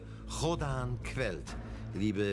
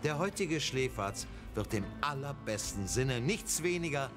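announcer; 5 semitones lower than usual. Acted. Grand announcer intro.

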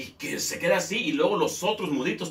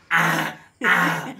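louder wordless grunts; mute grunting; 3 semitones higher, 7 dB louder than usual. Retold or imitated. The other mutes grunting back in chorus.

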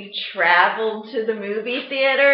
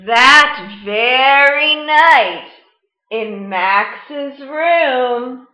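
story reading; 7 dB louder than usual. Acted. Teacher reading Where the Wild Things Are to the class, storytime voice. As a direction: read it like storytime, letting big moments swell. Teacher reads picture book aloud.

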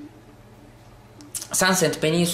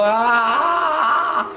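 high startled scream; sudden high shriek at the crash; sharp cry; 10 semitones higher, 3 dB louder than usual. Real, not acted. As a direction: yelp as the car crashes. Crash in race.

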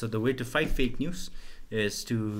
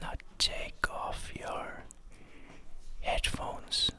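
slow, breathy ASMR whisper; 6 dB quieter, much slower than usual. Pretend. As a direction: whisper close to the mic. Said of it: "We're doing A S M R".

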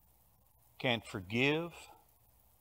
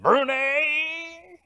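name called out much higher, drawn out; a friend's teasing nickname call; Jules's way of saying it; 13 semitones higher, 5 dB louder than usual. Retold or imitated. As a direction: mimic your best buddy saying your name his own odd way. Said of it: "He always kinda talked like that".

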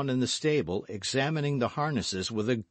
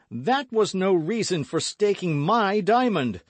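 high, loud indignant outburst; tense, loud reproach; agitated protest; 11 semitones higher, 8 dB louder than usual. A narrator's reading. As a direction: object hotly, voice pitched right up. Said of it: "closing his hand with a nervous spasm".

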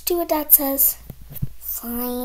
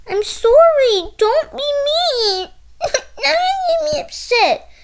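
mock hurt whine; 13 semitones higher, 6 dB louder, slower than usual. Child pretends hurt feelings.

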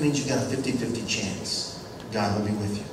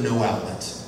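ordinary talk, nothing marked

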